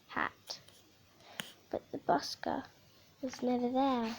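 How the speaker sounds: hushed, almost breathless whisper. A narrator's reading. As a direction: drop to a whisper. Hushed sad turn.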